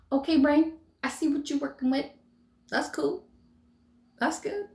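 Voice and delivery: high, light, amused praise; impressed high voice. Retold or imitated. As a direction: talk up to your own brain, impressed. Talking to own brain, impressed.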